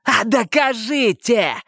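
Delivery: high, drawn-out taunt; snarling challenge. A narrator's reading. Detainee daring officers to prove it, slow high taunt. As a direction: dare them nastily, stretching the words. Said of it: "ощерившись".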